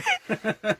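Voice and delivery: high giggle breaking the line; real high giggle; corpsing giggle. Real, not acted. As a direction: crack up mid-word. Corpsing on the restart.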